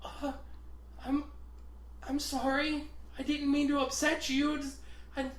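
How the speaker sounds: high, halting, timid voice; imitated near-tears apology. Upset coworker apologizing to box guy, imitated panicky meekness. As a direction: stammer a small high apology. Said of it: "like, about to cry".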